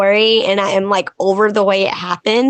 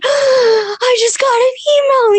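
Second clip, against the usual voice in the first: gasp, then slow high-pitched squeal; squeaky, drawn-out mock panic; freaking out; 14 semitones higher than usual. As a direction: re-enact breathless, overwhelmed excitement. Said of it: "freaking out. I can't breathe".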